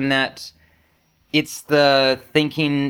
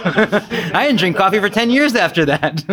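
laughing; 7 semitones higher than usual. Real, not acted. Laughing at own story.

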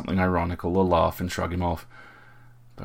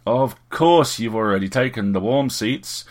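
louder, higher mock grievance; theatrical complaint; 4 semitones higher, 6 dB louder than usual. A narrator's reading. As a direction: complain like a ham actor. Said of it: "he complained with a theatrical slouch".

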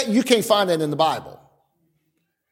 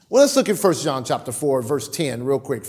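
ordinary delivery

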